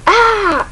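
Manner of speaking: high, loud short scream; startled scream. Acted. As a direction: cry out under attack. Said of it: "she says".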